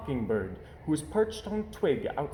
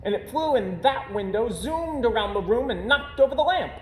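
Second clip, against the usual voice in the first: cub voice; 10 semitones higher than usual. Acted. Cubs tell the lie.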